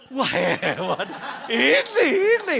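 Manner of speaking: loud, high laughing amazement. Real, not acted. The preacher laughs at the hosts serving biscuits, loud and high. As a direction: laugh in happy surprise.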